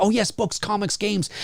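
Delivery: lightly mocked enthusiasm; eager superfan. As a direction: imitate a greedy fan lapping it up. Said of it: "people out there that love that".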